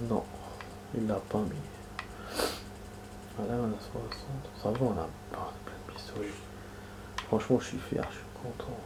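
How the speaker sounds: hushed whisper, barely any voice. Real, not acted. Gamer whispering to viewers while sneaking past enemies. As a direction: drop to a whisper so the game can't hear you. Quiet commentary during tense gameplay.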